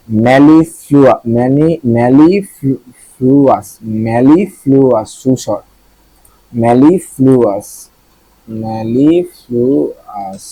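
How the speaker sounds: mellifluous stretched out very slowly; slow word drill. Shown as a demonstration. Teacher drilling mellifluous slowly for students. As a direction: draw out every syllable of mellifluous. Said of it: "drilling the word slowly".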